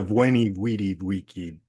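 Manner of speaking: "Weni Weedy Wiki" said WEH-nee WEE-dee WEE-kee; W for every V in "Weni Weedy Wiki"; classical Latin pronunciation. Shown as a demonstration. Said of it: "the classic pronunciations".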